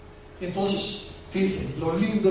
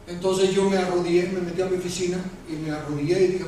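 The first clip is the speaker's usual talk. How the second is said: ordinary talk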